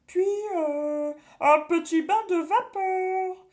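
high, loud witch voice; a witch cooking up torment; sneaky cartoon thief. A narrator's reading. Witch scheming inside belly.

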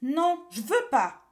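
put-on high, loud, drawn-out child's refusal; disgusted cry. Boy refuses to be cooked by the witches, disgusted cry. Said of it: "s'écria Pierre dégoûté".

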